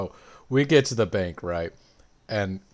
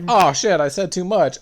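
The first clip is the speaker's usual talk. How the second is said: higher, louder hammed-up oops; caught out; 9 semitones higher, 8 dB louder than usual. Villain realising she confessed, mock dismay. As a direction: blurt it as a mock slip. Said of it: "And he's like".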